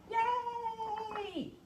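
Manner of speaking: high sung cheer; elves cheering. Acted. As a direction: cheer in a sing-song. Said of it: "all say".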